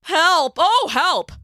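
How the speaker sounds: put-on high, loud cry for help from an old lion; feeble holler. Lion calling from his cave for help. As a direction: fake a desperate shout for help. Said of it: "he hollered out, loud as his old voice could".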